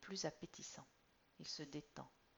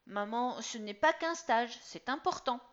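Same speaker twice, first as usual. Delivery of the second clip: put-on high, loud stamping boy; stamping teen; 6 semitones higher, 10 dB louder than usual. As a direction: plead like a stomping kid. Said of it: "trépigne Jean-Baptiste".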